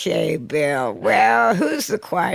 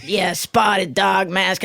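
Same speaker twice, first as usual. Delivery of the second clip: ordinary delivery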